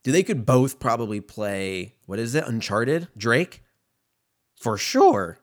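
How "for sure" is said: voice cracking. Real, not acted. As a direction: voice cracks on a loud word. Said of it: "Why'd my voice crack like that?".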